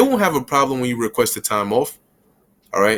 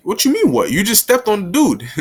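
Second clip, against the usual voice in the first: mock confrontation; 8 semitones higher than usual. Confrontational reply to a bump.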